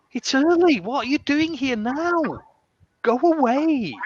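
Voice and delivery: teacher playfully chasing away an early student; mock annoyance. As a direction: pretend to be put out and shoo her off.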